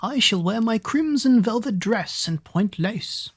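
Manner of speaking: a vain sister boasting slowly; haughty eldest sister. A narrator's reading. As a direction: lift the voice, slow and vain. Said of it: "The eldest said".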